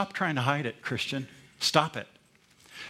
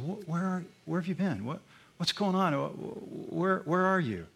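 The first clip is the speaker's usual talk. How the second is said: softer, halting, pleading where are you; tender fatherly questioning; 3 semitones higher, 4 dB quieter than usual. Pretend. Preacher plays a loving parent gently asking the child where they've been. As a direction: coax gently like a worried father.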